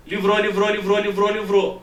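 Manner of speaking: LIVROU chanted loud, evenly pulsed; intensified echo. Shown as a demonstration. Teacher showing how reflected sound gains intensity, loud chant. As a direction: pound out LIVROU in a forceful monotone loop. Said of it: "Olha a intensidade do som".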